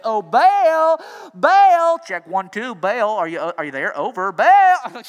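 loud mock radio call; calling over a radio. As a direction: call "Baal" like testing a mic. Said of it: "Just turn up the volume".

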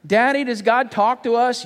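put-on high, drawn-out child's question; little daughter. Daughter stalling dad at bedtime, high childish questioning. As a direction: ask like a stalling little girl. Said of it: "I told my daughter".

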